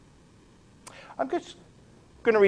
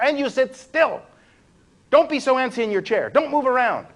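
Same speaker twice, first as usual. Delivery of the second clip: strict teacher; 8 semitones higher, 3 dB louder than usual. Retold or imitated. Mocking a strict classroom teacher.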